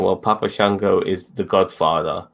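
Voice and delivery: ordinary talk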